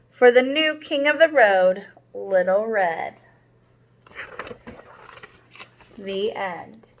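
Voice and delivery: put-on lively read-aloud for young kids; storybook read-aloud. A narrator's reading. Teacher reading a story to her class, lively storytelling. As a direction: read it out like a children's picture book. Reads picture book to class.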